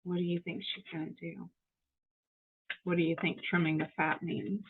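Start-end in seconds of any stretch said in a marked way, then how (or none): none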